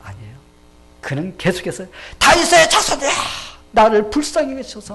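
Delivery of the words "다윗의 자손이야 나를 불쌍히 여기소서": high, very loud cry for mercy; shouted plea. Acted. Blind beggar begging mercy from Jesus, high desperate shout. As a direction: yell desperately to be noticed. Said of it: "하면서 막 소리 지르기 시작했어요".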